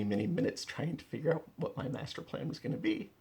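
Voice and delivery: ordinary talk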